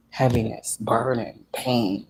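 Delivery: ordinary talk, nothing marked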